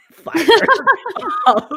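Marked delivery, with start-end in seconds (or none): 0.1-0.3 put-on fixated child. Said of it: "she said I was so fixated".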